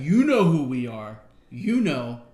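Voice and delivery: slow, loud, knowing taunt; cocky wrestler taunt. Retold or imitated. Razor Ramon taunting Bischoff, slow loud swagger. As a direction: lean in like everyone already knows. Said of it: "And he's like".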